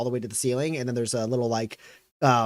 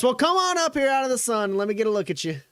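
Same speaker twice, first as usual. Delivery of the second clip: put-on much higher, fussing old lady voice; welcoming old woman; 9 semitones higher, faster than usual. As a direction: beckon them like a doting grandma. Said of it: "and she goes".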